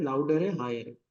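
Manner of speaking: ordinary talk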